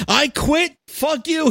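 a winner cursing out his whole office, gloating; mock furious tirade. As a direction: rant at everyone like you're finally free. Winner quitting on the spot.

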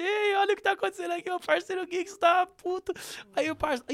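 a high teasing caption for the video; filming voice. Retold or imitated. Filming his friend for the group chat, high teasing voice. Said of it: "Eu falei assim".

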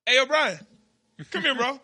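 mock call to a coworker. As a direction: hail a coworker to come over. Calling coworker over.

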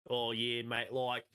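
hammed-up exaggerated Australian; over-the-top Australian accent; broad Aussie.

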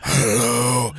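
low "Hello." in a deep voice. Shown as a demonstration. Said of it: "woman with a deep voice".

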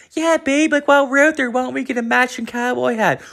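very high, squeaky mock girlfriend; shrill cartoonish girlfriend impression. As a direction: ham up the eager partner suggesting hats. Mocking the matching couple, girlfriend.

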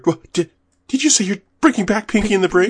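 high, astonished re-enactment; excited trailer quote. Retold or imitated. Retelling a trailer to friends, excited disbelief. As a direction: ask it high-pitched, in mock amazement. Acting out the trailer's surprised question.

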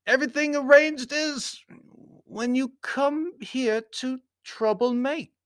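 very high, accusing Baron; stern foreign Baron. A narrator's reading. Baron accusing his host of troublemaking, high stern voice. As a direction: accuse him in a high formal voice.

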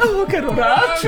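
high, loud laughing exclamation. Real, not acted. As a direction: burst out laughing on it. Joins the laughter, repeats phrase.

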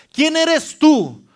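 drawn-out, high, loud challenge; defiant challenge. Shown as a demonstration. Preacher showing how to rebuke a mountain, drawn-out and loud. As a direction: stretch it out and defy the mountain. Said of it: "vas a poder tú decirle".

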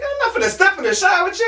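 high, fast, loud protest; hammed-up disbelief at the demand; indignant refusal. Storyteller refusing the resident's challenge, indignant.

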